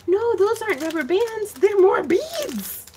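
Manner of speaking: high, excited discovery; surprised. Real, not acted. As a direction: gasp then rise high in surprise. Surprised by beads.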